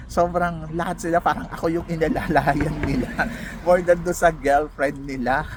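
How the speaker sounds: nervous laughter while telling; laughing through words. Real, not acted. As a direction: let the laugh spill over the words. Laughing while recalling the wake.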